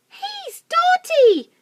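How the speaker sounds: very high, loud, slow taunt; squeaky mocking chorus. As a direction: jeer like small animals. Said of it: "they cried".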